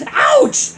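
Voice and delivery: high, loud yelp; scream of pain. Acted. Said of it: "Luigi screamed".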